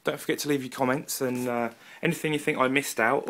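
ordinary delivery

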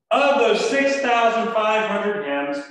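normal talking voice

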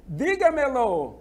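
Dígamelo like picking up a call; mock phone answer. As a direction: lightly act someone answering the phone. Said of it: "alguém ligou e o outro vai e diz".

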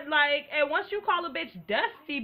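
ordinary delivery

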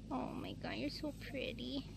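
soft whispered awe; real hushed amazement; awed whisper. Real, not acted. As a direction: breathe it out in wonder.

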